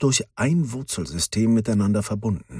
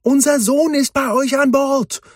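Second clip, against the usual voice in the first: much higher, louder call across the air; 7 dB louder than usual. A narrator's reading. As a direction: shout it up to the airship. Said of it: "rief Meridian". (laut rufend, viel höher)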